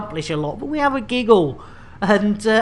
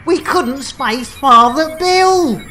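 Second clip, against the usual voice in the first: louder, higher mock dismay; surprised dismay; 7 semitones higher, 6 dB louder than usual. Real, not acted. Streamer telling chat they could not space Father Bill: loud surprised dismay. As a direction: sound thrown by the failure. Airlock plan fails on stream.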